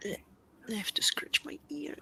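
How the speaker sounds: slow unvoiced whisper. Real, not acted. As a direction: whisper it slowly, no voice at all. Whispered aside off mic.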